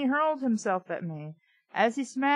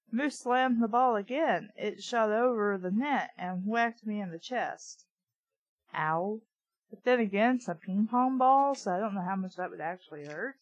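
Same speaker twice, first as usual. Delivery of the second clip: ordinary delivery, nothing marked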